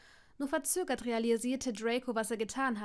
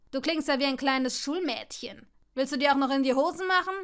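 louder, fast jeering taunt; loud mockery; 3 semitones higher, 8 dB louder than usual. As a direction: rub it in, faster and louder. Malfoy mocks Neville.

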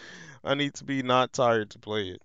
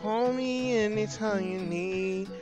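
higher, slow mock sweet voice; high character voice; 7 semitones higher than usual. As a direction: play a sugary character lightly.

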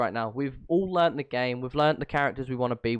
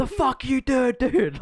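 high, loud mock rage at a sub; mock angry shout; 11 semitones higher, 4 dB louder, slower than usual. Podcaster imitating streamers yelling at subs, mock angry. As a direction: yell it like a furious gamer. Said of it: "they're like".

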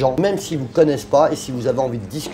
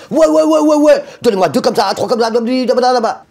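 much higher and louder, gabbling yes yes yes; an impatient buyer grabbing jackets; eager customer; 9 semitones higher, 5 dB louder than usual. Retold or imitated. The customer to the founder, gabbling eagerly.

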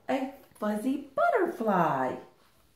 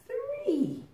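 sing-song count; 3 dB quieter than usual. A narrator's reading. Counting chicks, sing-song high.

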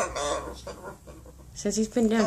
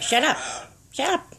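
demo phrase for bird; 5 semitones higher, 5 dB quieter than usual. Shown as a demonstration. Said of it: "How about".

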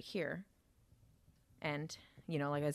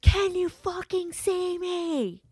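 much higher, louder, slower furious shout; retold yell; 13 semitones higher, 7 dB louder, slower than usual. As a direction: scream it in his face, losing control. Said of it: "he yells at me again".

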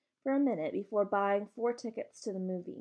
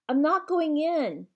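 louder, higher, slow near-shout of refusal; 8 semitones higher, 8 dB louder, slower than usual. A narrator's reading. Connor refusing to enter the theater, near shout. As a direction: dig in and half-shout it. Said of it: "he nearly shouted at me".

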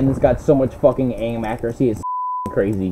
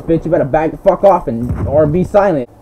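ordinary talk

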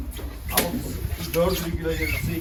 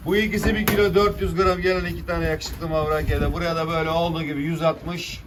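auctioneer; 3 semitones higher, 4 dB louder than usual. Acted. Fish auction calling prices.

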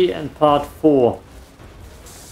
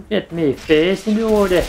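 amateur British accent, pitched higher; British accent attempt; 6 semitones higher than usual. Shown as a demonstration. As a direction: try a clipped British accent on the same line. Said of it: "need to work on my British accent".

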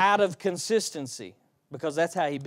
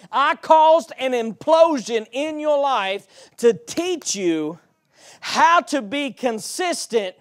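loud, high-pitched preaching with rising peaks; preaching at full force; 3 semitones higher, 5 dB louder than usual. Acted. Preacher voices God to the Israelites for the church, preaching. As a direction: pour out God's words on the people.

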